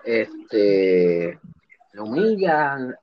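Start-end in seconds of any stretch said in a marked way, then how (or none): none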